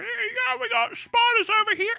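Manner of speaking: high put-on voice for a bragging Aussie; mock Aussie brag. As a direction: squeak it out as a cocky Aussie. Mocking Australians bragging about spiders.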